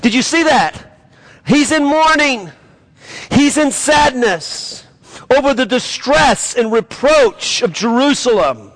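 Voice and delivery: high impassioned sermon delivery; preaching at full force. Acted. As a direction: press the grief home to the congregation.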